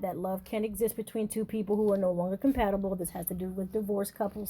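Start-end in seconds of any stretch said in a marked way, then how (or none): none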